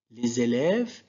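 liaison Z linking "les élèves". Shown as a demonstration. Teacher showing learners an example of liaison, Z linked in "les élèves". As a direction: say "les élèves" as one word, with Z before "élèves". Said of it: "il y a une liaison".

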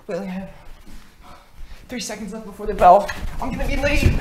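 loud, high rush of alarm; panicking. Acted. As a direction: cry out as time runs out. About to miss the bell.

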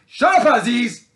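sudden high, full-force yell; explosive high-pitched command to shut up; shouted rebuke. Real, not acted. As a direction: shout them down mid-talk.

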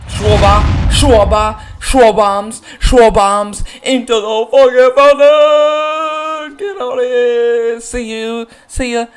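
high, loud, stretched chant of the name; real home run celebration; hype cheering. Real, not acted. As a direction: roar the name as it flies out.

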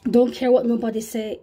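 ordinary delivery

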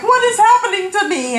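high, mock-despairing wail; grumpy whine. Wife lamenting to husband on the drive, grumpy whine. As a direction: act out self-pitying dismay. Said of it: "Why have you been so grumpy".

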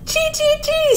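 very high little bird chirps; tiny piping bird call; chirping chickadee. A narrator's reading. Chickadee calling from the snowman, high chirps. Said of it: "said the chickadee".

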